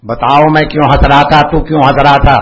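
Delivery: loud insistent demand; angry pressing. Retold or imitated. Guru pressing the silent disciple, loud and insistent. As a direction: press him hard for an answer.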